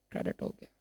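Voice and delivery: voiceless hint of the answer; whispered answer. Shown as a demonstration. Teacher whispering the answer to the class.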